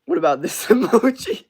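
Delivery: high, loud laughing question. Real, not acted. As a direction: laugh out the question about the emoji. Laughing at a chat emoji.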